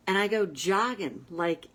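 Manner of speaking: ordinary talk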